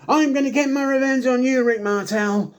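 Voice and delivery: high, loud mock threat to a rival; squeaky mock vow of revenge; imitating a wrestler's threat. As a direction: ham up the revenge promise.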